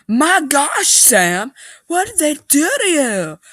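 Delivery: high, loud horrified cry; shocked distress. Acted. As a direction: gasp it out at the sight of the wound. Finding the wounded dog.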